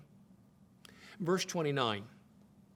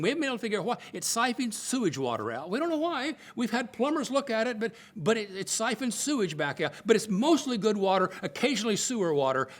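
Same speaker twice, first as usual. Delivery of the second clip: higher and louder, hammed-up reassurance about sewage; mock cheerful official; 6 semitones higher, 5 dB louder than usual. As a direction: sell the absurd fountain like a breezy manager. Mock official explaining sewage fountain.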